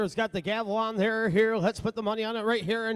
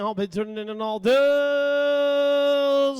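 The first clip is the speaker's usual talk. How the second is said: slow, stretched, higher call before the sale; drawn-out closing call; 5 semitones higher, 5 dB louder, much slower than usual. Acted. Auctioneer closing bidding with a slow stretched call. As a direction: stretch every word to close the bidding.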